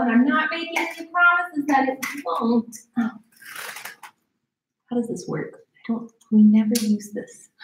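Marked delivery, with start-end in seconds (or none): none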